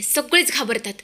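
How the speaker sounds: ordinary delivery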